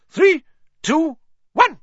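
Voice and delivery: high, slow, loud commanding call; stern referee. A narrator's reading. Madam Hooch to the players, slow commanding call. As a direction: draw out each word as an order.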